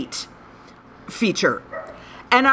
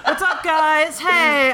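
YouTuber voice; 5 semitones higher, slower than usual. Shown as a demonstration. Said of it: "How to YouTube voice?".

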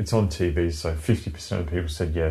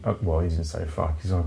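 ordinary delivery

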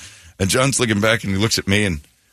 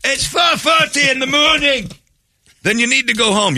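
security guard shouting; 12 semitones higher than usual. Retold or imitated. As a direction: build from firm to a full shout at the guests. Security waking the drunk guests.

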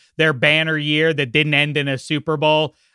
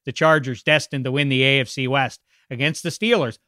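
ordinary talk

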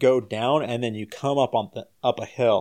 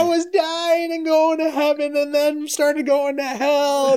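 high, loud, slow hammed-up panic; frantic panic; 4 dB louder, slower than usual. Student waking in the car, telling the roommates in hammed-up panic. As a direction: blurt out the nightmare in mock terror.

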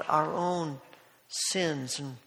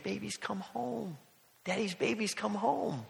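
put-on soft, slow, higher tender coo to a baby; tender father; 5 semitones higher, 9 dB quieter than usual. Father cooing softly to his messy baby. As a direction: coo it softly like a loving dad.

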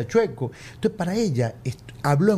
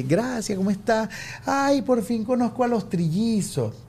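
lightly imitated lady, higher pitched; gushing lady; 6 semitones higher than usual. Lady meeting the triplets in the park, lightly gushing. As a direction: imitate an effusive admirer. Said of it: "una señora me llega y me dice".

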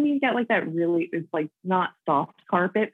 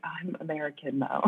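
much softer, deflated admission; sheepish retold reply; 9 dB quieter than usual. Guest disappointing people who expected an Australian accent, sheepishly quiet. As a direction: drop the volume for an apologetic correction.

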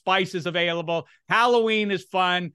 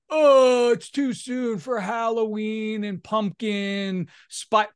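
put-on exaggerated griping; mock complaining; 2 semitones higher, slower than usual. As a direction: imitate a chronic moaner. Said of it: "What I can't take is the perennial complainers".

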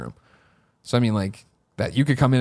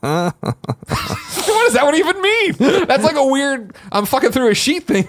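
much higher, incredulous; high-pitched bewildered question; baffled; 10 semitones higher than usual. Real, not acted. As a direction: squeak it out in disbelief. Baffled by keyhole porn.

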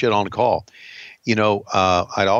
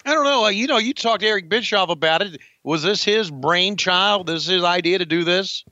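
put-on interviewer voice; 8 semitones higher, faster than usual. Answers in the same put-on voice.

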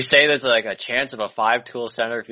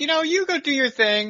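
imitating the owner. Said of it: "he's like".

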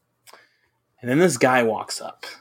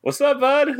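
much higher, playful bro voice; mock dude greeting; 13 semitones higher than usual. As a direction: toss off a goofy high hello.

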